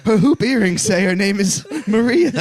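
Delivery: high-pitched, hammed-up British accent; mock British accent. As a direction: play a flustered Brit, pitched well up. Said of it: "a Hugh Grant movie".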